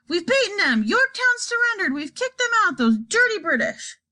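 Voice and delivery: high triumphant shout. A narrator's reading. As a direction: cheer a victory. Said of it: "sat bolt right up in bed and shouted".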